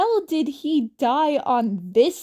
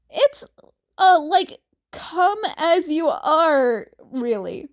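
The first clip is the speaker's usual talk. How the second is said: strained high voice; 4 semitones higher, 6 dB louder than usual. Acted. Character's strained high answer.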